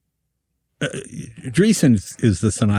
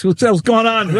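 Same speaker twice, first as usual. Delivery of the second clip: much higher, loud startled bark; Frank's temper; 11 semitones higher, 3 dB louder than usual. Retold or imitated. Frank startled by the rolling log, angry shout. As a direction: snap at the room like a hot-tempered boss. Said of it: "Frank had a temper".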